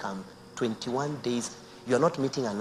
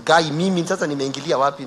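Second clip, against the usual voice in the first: incredulous man; 4 semitones higher, 13 dB louder than usual. Retold or imitated.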